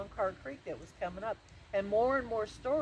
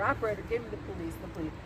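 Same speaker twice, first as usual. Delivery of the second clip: rushed, urgent phone request; hurried; faster than usual. Acted. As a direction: rattle it off in a hurry. Frantic call to the operator.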